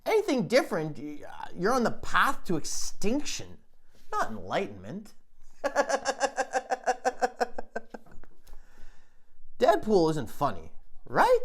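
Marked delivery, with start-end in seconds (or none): 5.2-9.5 long airy laugh. Real, not acted. Comedian laughs at own joke.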